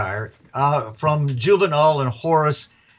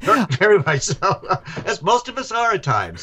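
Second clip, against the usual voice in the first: laughing along; 5 semitones higher than usual. Real, not acted. Laughing agreement after host's joke.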